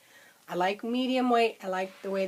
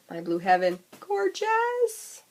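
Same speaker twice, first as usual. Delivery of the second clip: squealing with delight; rising to a high squeal on gorgeous; excited squeal; 12 semitones higher, 4 dB louder, slower than usual. Retold or imitated. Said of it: "and I went".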